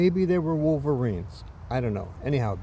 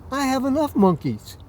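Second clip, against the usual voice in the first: higher, louder snarky retort; 10 semitones higher, 4 dB louder than usual. Retold or imitated. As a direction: put him down with a sneer. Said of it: "Boy, real snarky".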